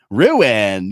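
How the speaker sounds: put-on "Ruin" said as "roo-in" in a Valley Girl drawl; a light Valley Girl "Ruin". Shown as a demonstration. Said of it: "You sound like a fucking Valley Girl".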